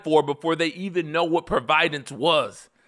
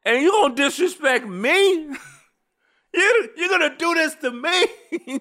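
high, incredulous squeal breaking into laughter; hammed-up wounded disbelief; mock outraged parent; 13 semitones higher than usual. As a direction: demand it high, as if personally affronted.

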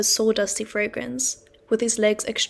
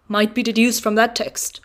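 louder, churchy declaiming voice; theatrical declamation; 5 dB louder than usual. Acted. Mr Wopsle sermonising at the boy over dinner, theatrical.

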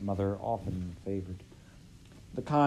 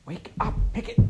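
sharper, louder whisper; 12 semitones higher, 7 dB louder than usual. A narrator's reading. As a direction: whisper harder to rouse him. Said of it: "she whispered louder".

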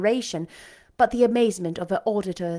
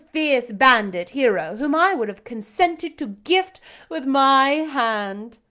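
louder and higher, queenly commands; haughty lady commanding a singer; imperious belle; 5 semitones higher, 5 dB louder than usual. A narrator's reading. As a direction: order him about like a mock queen. Blanche praising a bandit hero.

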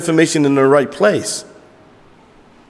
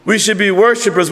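ordinary delivery

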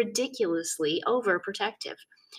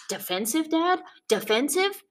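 indignant; 10 semitones higher than usual. A narrator's reading. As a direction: throw the word back in disbelief. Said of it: "sinking to their capital letter level".

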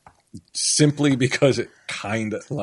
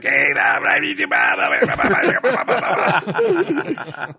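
mock chatter; 11 semitones higher, slower than usual. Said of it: "somebody was like".

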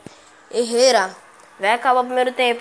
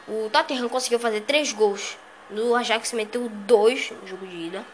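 usual speaking voice

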